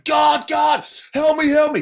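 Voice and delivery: high-pitched desperate shouts; imitated terrified screaming. Man behind him in the falling plane, high desperate screams. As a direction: scream it like a panicking passenger. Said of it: "everybody started to scream".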